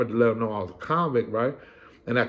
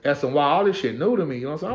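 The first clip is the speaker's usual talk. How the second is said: normal talking voice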